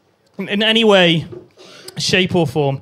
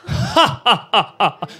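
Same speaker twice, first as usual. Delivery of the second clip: mock chuckle; slower than usual. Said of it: "they always do it like".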